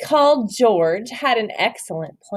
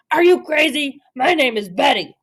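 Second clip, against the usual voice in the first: monster voice; 3 semitones higher than usual. Acted. Angry monster corrects George.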